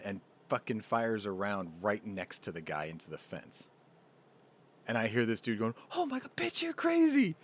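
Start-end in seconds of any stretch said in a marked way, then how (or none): 5.9-7.4 very high-pitched mock freak-out, louder at the end; panicked. Guy fleeing after she fires a round, panicked. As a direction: squeal it like a comically terrified man.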